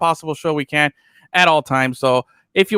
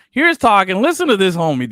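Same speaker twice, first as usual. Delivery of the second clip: normal talking voice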